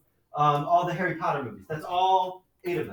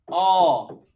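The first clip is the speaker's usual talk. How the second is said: All said slowly and punched louder; stress on All; 7 dB louder than usual. Shown as a demonstration. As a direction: lean hard on All as you write it. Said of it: "And I'm gonna emphasize all".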